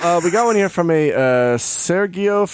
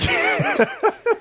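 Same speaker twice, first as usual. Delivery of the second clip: much higher, breaking into a squeaky laugh; can't hold the giggle, high; high squealing laugh. Real, not acted. Host cracks up mid-recommendation, high squealing laugh.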